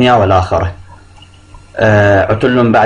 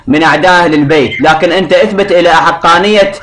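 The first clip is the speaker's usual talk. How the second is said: heated arguing; 6 semitones higher than usual. Real, not acted. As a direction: push your point over him. Caller argues heatedly with sheikh.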